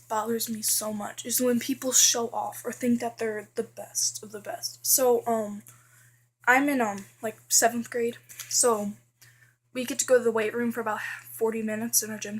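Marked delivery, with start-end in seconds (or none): none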